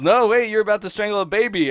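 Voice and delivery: high, loud imitated protest; Bart. Bart stopping a strangling, mock urgent. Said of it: "and then Bart goes".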